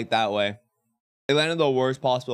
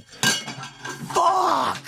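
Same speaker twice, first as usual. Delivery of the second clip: sudden high shout of dismay; pained yell. Real, not acted. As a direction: yell out as the food drops. Dropped his breakfast, yells.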